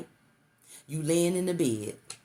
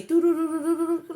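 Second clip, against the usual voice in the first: mock electronic chime, high and louder; TV startup chime; 13 semitones higher, 5 dB louder than usual. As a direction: sing the TV's startup jingle much higher. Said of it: "it say".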